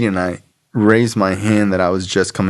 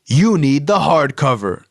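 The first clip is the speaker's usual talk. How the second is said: mock yell; 6 semitones higher than usual. Woman answering newcomer's book question, mock yelling. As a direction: imitate a bossy woman yelling, without real volume. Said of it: "And she yells".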